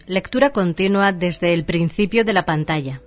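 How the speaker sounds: normal talking voice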